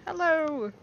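big bright hello to strangers; loud greeting. Acted. Player greeting the creatures loudly. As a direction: call out hello to new friends.

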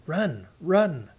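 Eocharan's frantic warning to flee, shouted; urgent cry. A narrator's reading. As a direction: yell a warning of danger. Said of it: "Eocharan cried".

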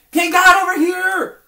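put-on shrill, very loud, slow childish yell; a child bellowing at God for attention; tantrum shout. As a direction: scream it like a kid throwing a tantrum. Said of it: "just shouting and throwing a tantrum".

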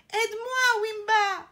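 high, loud supplication; desperate pleading. A narrator's reading. Trapped elephant begging the lion for help, pleading. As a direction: beg loudly for rescue. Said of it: "supplie-t-il".

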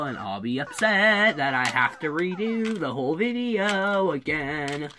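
ordinary talk, nothing marked

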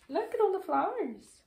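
high, fast and excited; excited child voice. A narrator's reading. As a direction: gush like a thrilled little kid.

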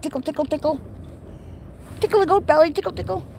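high, teasing pet voice; high-pitched tickly chant; playful tickle talk. Real, not acted. Tickling a goat's belly.

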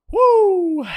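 high, loud whoop of joy; excited whoop. Real, not acted. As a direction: let out a thrilled cheer. Streamer cheering at big donation.

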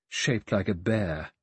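usual speaking voice

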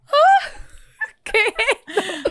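high, loud laughing squeal; laughing shriek of delight; fangirl shriek. Retold or imitated. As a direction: squeal like a smitten fan. Fangirl reaction to song.

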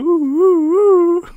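high hooting phantom woo; ghostly woo. Retold or imitated. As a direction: hoot it like a ghost. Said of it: "behind the woo".

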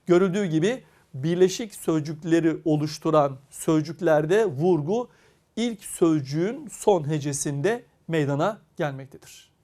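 ordinary talk

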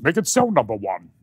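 English starship commander impression; Captain Picard. Comedian doing Patrick Stewart, Captain Picard impression. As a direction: give the order as a British captain. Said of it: "I do him as Captain Picard".

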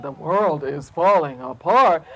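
deep, slow bear voice; big old bear. A narrator's reading. Bear taking up the rabbits' alarm, slow bear voice. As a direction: rumble it low and slow.

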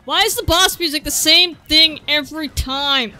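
loud exasperated question; loud complaint. Real, not acted. Gamer gripes at the boss music, loud and exasperated. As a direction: vent at the repeating music loudly.

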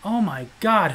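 ordinary talk

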